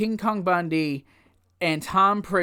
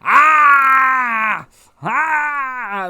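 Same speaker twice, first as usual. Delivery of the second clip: long high shouted "ah"; loud, sustained mock scream; imitated wrestler yell; 7 semitones higher, 8 dB louder than usual. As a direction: holler a drawn-out war cry. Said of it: "Does the whole".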